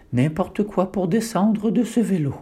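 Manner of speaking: usual speaking voice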